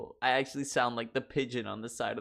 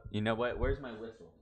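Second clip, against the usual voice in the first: normal talking voice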